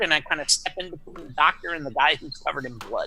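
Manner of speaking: ordinary talk, nothing marked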